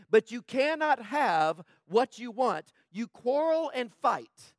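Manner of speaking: loud, high and slow; booming scripture reading; loud preaching. Shown as a demonstration. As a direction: hurl the verse out. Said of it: "first loud reading, later quiet".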